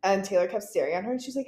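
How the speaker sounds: ordinary delivery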